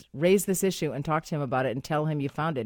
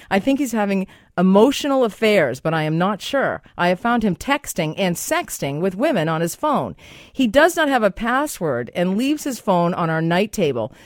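loud, projected radio reading; emphatic on-air letter reading; letter read aloud; 3 semitones higher, 6 dB louder than usual. Acted. Reads a listener letter aloud.

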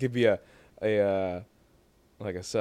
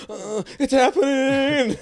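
high, slow, louder mock gasp of it's happening; mock movie line; 12 semitones higher, 5 dB louder, much slower than usual. Friend quoting a movie line, high and slow. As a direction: squeal it out like a movie moment. Quote for next time coming.

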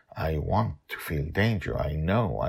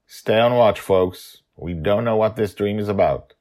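firm, louder captain's order; rally the crew to stay alert; commanding leader; 5 semitones higher, 9 dB louder than usual. A narrator's reading. Justin gives orders.

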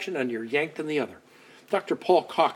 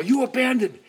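mock hurt accusation; 10 semitones higher, faster than usual. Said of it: "kind of go".

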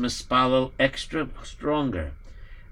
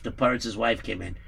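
plain reading voice

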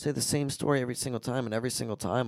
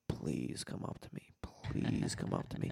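slow unvoiced whisper, much lower and softer; whispered plea; 9 semitones lower, 9 dB quieter, slower than usual. Spotting the scammer, he whispers a hopeful plea to himself, slow whisper. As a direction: whisper it under your breath, willing him over. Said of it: "and I was like".

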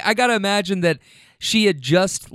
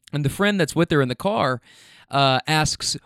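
ordinary talk, nothing marked

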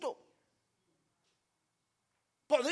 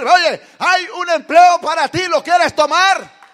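loud, high, near-shouted sermon; shouted preaching; 5 semitones higher, 20 dB louder than usual. Acted. Preacher promising the congregation jobs, shouting.

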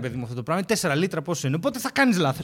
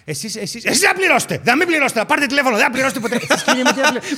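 building mock agitation; 6 semitones higher, 5 dB louder than usual. Clerk's building agitated repeated demand. (ο υπάλληλος ανεβάζει φωνή, ψηλά και δυνατά)

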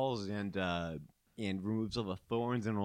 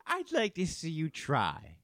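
taunting princess; 7 semitones higher, slower than usual. Acted. GM voices the princess.

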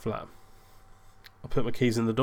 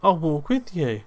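imitating the date; 7 semitones higher, 4 dB louder than usual. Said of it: "She goes".

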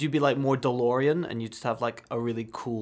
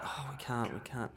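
sheepish refusal; 12 dB quieter than usual. Retold or imitated. Producers backing out.

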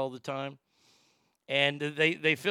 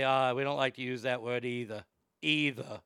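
lower-pitched mock Boston; Boston accent; 4 semitones lower than usual. Shown as a demonstration. Showing Boston has to be lower, Boston accent. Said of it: "you gotta be lower".

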